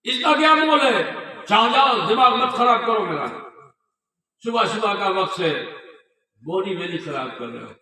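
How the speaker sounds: loud and high, brushing off a beggar; harsh scolding. Retold or imitated. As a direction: shoo him off with a loud, scornful telling-off. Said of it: "डांटा कस के".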